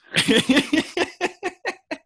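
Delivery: high burst of real laughter; big laugh. Real, not acted. Guest cracks up at his own story, real laughter. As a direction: laugh out loud at the punchline.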